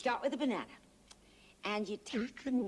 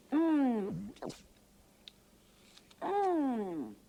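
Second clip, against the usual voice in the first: chewing hum; 3 semitones higher, 4 dB louder than usual. Real, not acted. Biting into an apple.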